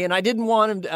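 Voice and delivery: ordinary delivery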